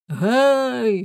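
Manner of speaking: a sharp loud hey to the crowd; calling out. Acted. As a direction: hail the crowd to get attention. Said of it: "гукнув Ламе". (гучний оклик до натовпу)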